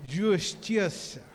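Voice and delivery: drawn-out Rio carioca accent. Shown as a demonstration. Preacher demonstrating Rio speech to the congregation, slow carioca accent. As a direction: stretch the vowels and hushed s like a carioca. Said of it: "Como o carioca fala".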